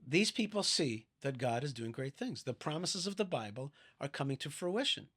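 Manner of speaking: usual speaking voice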